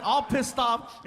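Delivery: ordinary talk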